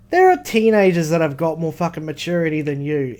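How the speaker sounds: usual speaking voice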